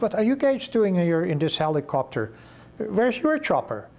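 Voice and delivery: high-pitched, baffled questions; puzzled questioning. Retold or imitated. Polar Shelf boss questions returning men, puzzled surprise. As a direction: ask it in surprise, pitch climbing.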